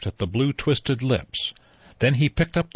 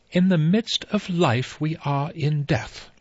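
slow, higher, clear pious pronouncement; 7 semitones higher than usual. Acted. Emily Brent pronouncing to the stunned guests, slow and clear. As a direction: intone it slowly like a stern spinster quoting scripture. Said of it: "in a clear voice".

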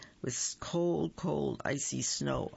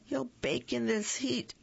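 mild cry out; 9 semitones higher than usual. A narrator's reading. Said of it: "Aunt Sassy cried".

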